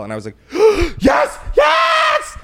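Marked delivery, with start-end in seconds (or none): 0.4-0.9 sharp high gasp of delight; excited gasp. Retold or imitated. Excited gasp at VIP tickets. 1.0-2.4 high, loud thrilled yes; excited shout. Retold or imitated. Thrilled reply to ticket offer.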